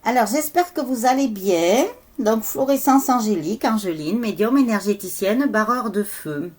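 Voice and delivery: high, loud, energized welcome; too loud. Real, not acted. Healer introducing themself to viewers, loud and worked up. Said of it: "je parle trop fort".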